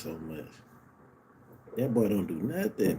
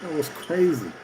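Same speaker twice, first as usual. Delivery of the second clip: incredulous outburst; 11 semitones higher than usual. Real, not acted. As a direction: react to a baffling turnover, voice shooting up. Disbelief at a bad turnover.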